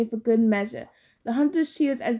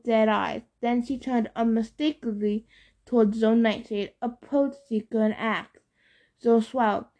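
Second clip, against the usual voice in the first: ordinary delivery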